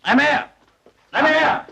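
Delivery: calling a name across the room; repeated calls for Amer. Acted. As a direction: shout the name to find him.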